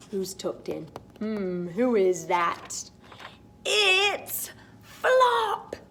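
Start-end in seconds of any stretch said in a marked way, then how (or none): 3.6-5.8 high happy cry; joyful cry. Acted. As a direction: cry out with delight. Children cry out happily.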